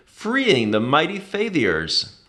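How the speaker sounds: ordinary delivery, nothing marked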